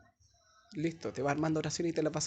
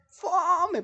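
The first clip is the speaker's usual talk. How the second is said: high, loud exclaimed fome; loud mock groan of boredom; boring!; 7 dB louder than usual. Teacher exclaims boring verdict.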